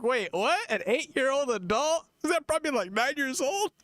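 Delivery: high, baffled voice; confused. Retold or imitated. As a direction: squeak it out in disbelief. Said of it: "You're like".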